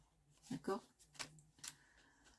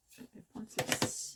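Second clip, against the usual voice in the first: whispered aside; 4 dB quieter than usual. Real, not acted. Picking an ink, aside.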